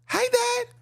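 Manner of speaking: put-on very high little-girl voice; tiny, high child greeting her father; little daughter. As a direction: squeak it like a young daughter. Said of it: "Father come to the daughter".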